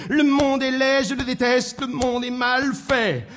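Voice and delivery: insistent droning gripe, last word drawn out; grumbling. Acted. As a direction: chant the complaint on one held note. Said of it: "un homme qui grognait comme ça".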